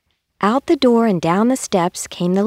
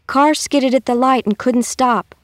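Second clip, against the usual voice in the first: higher, a touch louder yell; driver yelling; 6 semitones higher, 3 dB louder than usual. Acted. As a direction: driver shouting to passengers after braking. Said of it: "the driver yelled".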